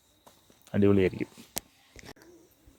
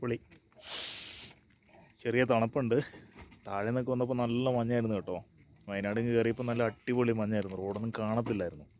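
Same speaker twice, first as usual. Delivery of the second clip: quiet; 15 dB quieter than usual. Real, not acted. Early morning, talking softly.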